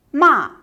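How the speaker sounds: "ma" falling sharply from high, loud; fourth tone. Shown as a demonstration. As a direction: drop "ma" hard and fast from the top. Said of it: "there are twenty-one initials".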